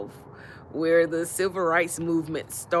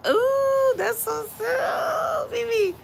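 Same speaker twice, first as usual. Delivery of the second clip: very high, slow, drawn-out coo; gushing delight; 4 dB louder, slower than usual. Real, not acted. Streamer thanking a sweet viewer, gushing delight. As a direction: squeal it out sweetly, stretching the words.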